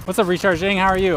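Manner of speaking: high, loud, cheerful hello; excited greeting. Real, not acted. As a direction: greet a friend with delight. Greeting a streamer friend loudly.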